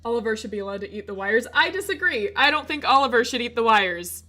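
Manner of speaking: ordinary talk, nothing marked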